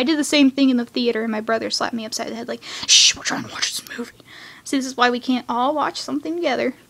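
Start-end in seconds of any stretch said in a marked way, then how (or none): 2.9-4.1 imitated annoyed whisper; shushing whisper. Brother shushing sibling in the theater, whispered scolding.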